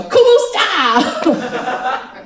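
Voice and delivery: loud, high, drawn-out hello; hammed-up Filipino hello, stretched long; exaggerated greeting. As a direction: belt out an over-eager greeting. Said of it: "but I said it like that".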